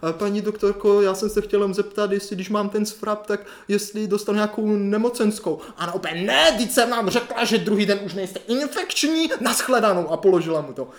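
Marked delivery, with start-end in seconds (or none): 0.1-5.6 put-on higher-pitched, hesitant boy caller; little boy. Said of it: "nějakej malej kluk". 6.2-10.1 high, slow scolding refusal; irate doctor. Retold or imitated. Doctor snaps at boy on phone.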